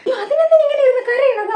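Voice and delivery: high, loud laugh through the words; scoffing laugh at a silly worry; laughing disbelief. Acted. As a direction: laugh off her fuss in disbelief. Laughs at the crying over marks.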